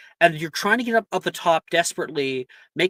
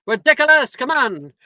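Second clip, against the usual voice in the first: mock spell cry; 10 semitones higher, 6 dB louder than usual. Class shouting the spell.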